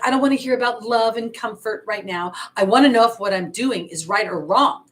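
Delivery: reenacting her annoyed outburst at the cards; frustrated complaint, replayed; retold frustration. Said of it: "I was freaking pissed off".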